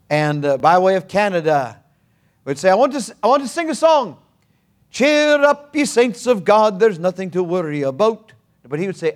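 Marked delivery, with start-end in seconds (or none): 2.7-8.3 high, fast Scottish preacher voice; Scottish brogue. Retold or imitated. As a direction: imitate the old Scot cheering the saints. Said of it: "he had a Scottish brogue".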